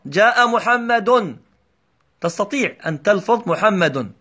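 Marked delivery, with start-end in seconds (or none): 0.0-1.4 slow read-aloud example. Shown as a demonstration. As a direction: read "جاء محمد" slowly, stressing the ḍamma on محمد. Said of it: "اقرأ". (قراءة بطيئة تبرز ضمة محمد)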